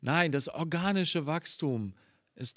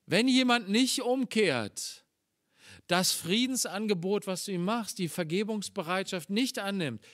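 1 fervent preaching; 5 semitones higher, 3 dB louder than usual. Acted.

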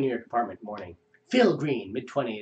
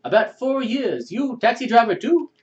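cabbie boasting in stock Russian; Russian accent; 11 semitones higher, 6 dB louder than usual. Acted. Driver tells Phil his years, mock Russian accent. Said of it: "a Russian stereotype come to life".

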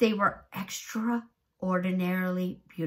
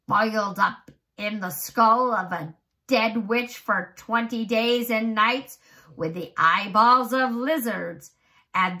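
wild raving; 4 semitones higher, 7 dB louder than usual. Acted. Old man reciting magic recipe to James, loud gleeful rant. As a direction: relish every gruesome ingredient loudly.

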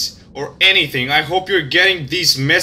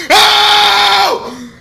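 pained yelp; 14 semitones higher, 4 dB louder than usual. Real, not acted. Hit in game.

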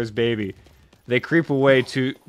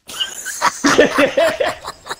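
burst of high laughter. Real, not acted. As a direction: crack up at the punchline. Laughs at the twist ending.

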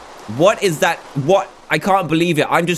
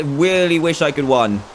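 ordinary talk, nothing marked

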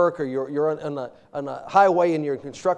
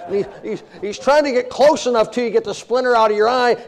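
ordinary talk, nothing marked

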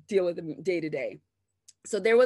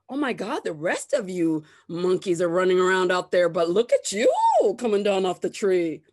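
louder, loud mock awe; 7 dB louder than usual. Imitating coworkers who praise her in mock amazement. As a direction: gush with fake amazement. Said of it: "it's said, like, in awe".

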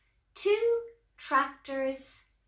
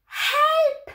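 high, loud shout; shouted cry for help; 13 semitones higher, 11 dB louder than usual. A narrator's reading. Olive calling for help at the fire, shouting. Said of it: "shouted Olive".

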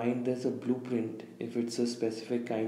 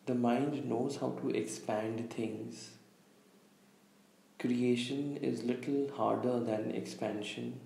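calm meditation guide, long pauses between phrases; unhurried, slightly hushed meditation delivery; guided visualization; 6 dB quieter than usual. Real, not acted. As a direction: lead listeners gently inward, leaving space after each instruction. Leads a guided visualization exercise.

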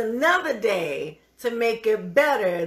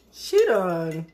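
normal talking voice

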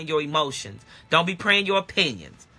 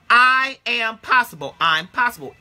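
ordinary talk, nothing marked

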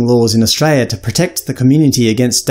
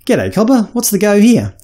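bright, higher Aussie hello; cheery ocker greeting; hearty Aussie greeting; 8 semitones higher than usual. Shown as a demonstration. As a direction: call out like a friendly Aussie bloke. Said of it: "C is for cobber".

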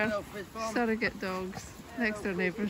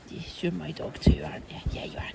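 whisper; 4 dB louder, faster than usual. Real, not acted. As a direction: whisper sweetly to the dog. Whispering to a dog.

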